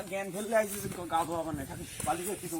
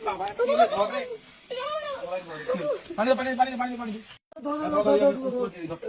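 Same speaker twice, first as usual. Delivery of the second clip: panicked shouting; 9 semitones higher than usual. Acted. Panic at well, calling help.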